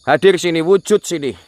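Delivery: high, loud order to appear; commanding summons. Real, not acted. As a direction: summon the unseen with force. (memanggil dengan lantang)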